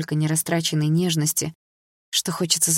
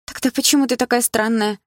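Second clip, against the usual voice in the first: higher exclaiming question; exclaiming friend; 7 semitones higher than usual. A narrator's reading. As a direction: blurt it out in disbelief. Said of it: "воскликнула Дилара". (Дилара восклицает, не понимая подругу)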